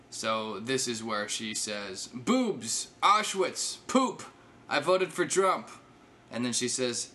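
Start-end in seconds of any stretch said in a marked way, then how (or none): 2.1-5.7 high, punchy, separate outbursts; mock provocative blurting. Comedian reading her attempt at something jarring, mock blurting. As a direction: fire off each shock word by itself, pitched up.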